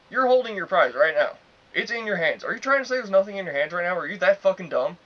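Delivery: loud, high exasperated telling-off; retold cussing out. Worker cussing out a customer holding his prize, exasperated retelling. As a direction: berate him loudly in disbelief, re-enacted. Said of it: "I was literally just cussing him out".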